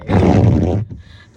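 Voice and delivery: big laughing shout; loud laugh; loud burst of laughter. Real, not acted. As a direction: let out a loud laugh at the storm.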